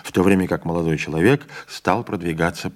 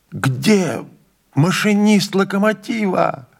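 high, loud panicked yell; 11 semitones higher, 5 dB louder, slower than usual. A narrator's reading. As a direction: shout it out in terror at the rocks. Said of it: "заорал он". (громкий испуганный крик)

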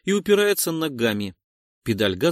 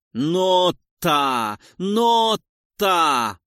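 loud, higher, pulsing shouts; rhythmic shouting; 6 semitones higher, 6 dB louder, much slower than usual. Acted. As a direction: shout each word like a push. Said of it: "Нота снова кричит".

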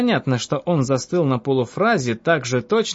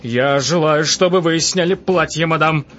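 king's voice turns louder and urgent; a hint of a lustful king, pressing; louder, urgent demand; changed voice; 3 semitones higher, 4 dB louder than usual. A narrator's reading. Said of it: "сказал изменившимся голосом".

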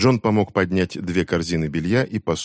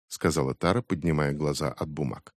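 ordinary delivery

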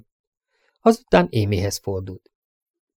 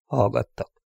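plain reading voice

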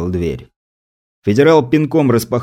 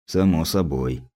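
softer, lower, drifting off; detached, fading; 5 semitones lower, 6 dB quieter, slower than usual. A narrator's reading. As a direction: repeat it quietly to yourself, lost in thought. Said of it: "как-то отрешённо кивнул опер".

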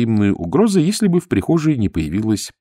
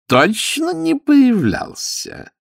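slow, high-pitched suspicious questioning; malicious cousin's drawn-out query; probing question; much slower than usual. A narrator's reading. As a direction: draw it out, testing the listener. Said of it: "поинтересовался зловредный кузен".

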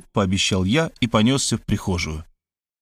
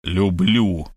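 grave voice; 3 semitones lower than usual. Acted. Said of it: "могильным голосом заверил я".

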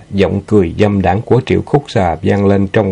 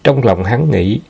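ordinary delivery, nothing marked

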